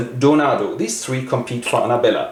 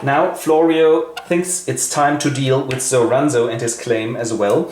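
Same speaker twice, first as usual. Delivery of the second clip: ordinary talk, nothing marked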